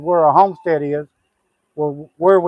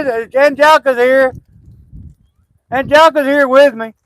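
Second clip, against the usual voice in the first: raised, high voice calling across the yard; 9 semitones higher, 8 dB louder than usual. Real, not acted. Calling to the neighbor down the road, loud and high. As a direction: call out so she hears you far off.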